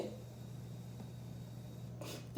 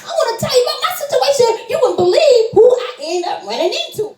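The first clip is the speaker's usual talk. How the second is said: fast excited chatter; 13 semitones higher, faster than usual. Retold or imitated. Retelling her own eager chatter.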